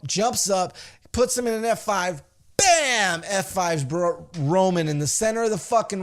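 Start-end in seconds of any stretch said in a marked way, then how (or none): 2.6-3.2 high, loud bam; exclaimed impact. Retold or imitated. Telling how Brock slams Roman, loud exclaimed bam. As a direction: slam the word down with the move. Imitating the F five slam.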